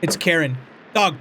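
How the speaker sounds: normal talking voice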